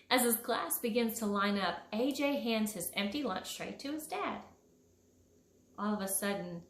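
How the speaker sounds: ordinary delivery, nothing marked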